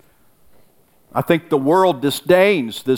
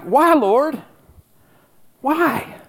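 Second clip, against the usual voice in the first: much higher, anguished cry to God; anguished plea; 11 semitones higher, much slower than usual. Pretend. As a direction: plead up to heaven with a breaking high voice. Voiced prayer over a lost friend.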